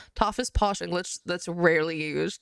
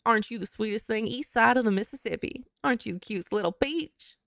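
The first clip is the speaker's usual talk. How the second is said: sugary Southern sweet-talk accent; Southern drawl. Shown as a demonstration. Streamer showing how to start the accent, Southern drawl. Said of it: "The easiest way to get started is with".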